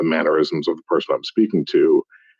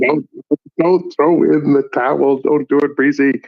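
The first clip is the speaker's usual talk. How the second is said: high, loud urgent pleading; urgent plea; 9 semitones higher, 4 dB louder than usual. Real, not acted. Friend begging Breezy not to throw in the towel, urgent and loud. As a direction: beg him not to give up.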